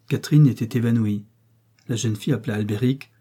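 ordinary delivery

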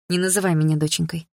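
plain reading voice

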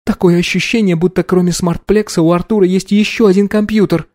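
high female character voice; focused young woman. Acted. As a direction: report intently in a high voice.

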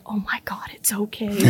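hushed, breathy comforting whisper; whispered reassurance. Retold or imitated. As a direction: imitate a stranger soothing a frightened child. Scary woman comforts screaming child.

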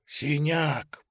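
a second whisper, pitch rising. Acted. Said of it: "пронёсся шёпот".

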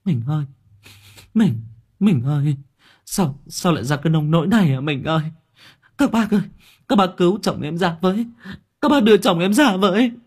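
high, wailing cries for her husband; keening, desperate pleading over the wreck; wailing plea. Acted. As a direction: beg the crowd through sobs. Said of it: "miệng bà rú lên van xin".

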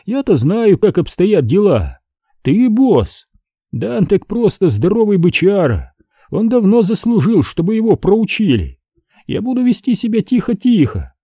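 высокий голос брата, уговаривает; говори высоко, легко убеждая брата; беспечный брат Шейн. A narrator's reading. Story figure Shane talks Hennessy round. (голос младшего по тону, заметно выше рассказчика)

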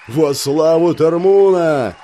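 loud, slow war cry; fifty warriors bellow it; warriors' roar. A narrator's reading. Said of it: "грохнули полсотни воинов". (рёв воинов)

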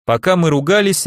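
ordinary delivery

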